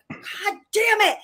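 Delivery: high, drawn-out mock cursing; slow, high-pitched comic frustration; retold frustration. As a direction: curse in hammed-up exasperation. Retold frustration at fast writer.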